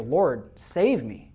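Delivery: very slow, drawn-out plea; preacher voicing Peter's plea, very slow; crying out like Peter. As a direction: stretch out each word like a desperate prayer. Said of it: "Cry out like Peter".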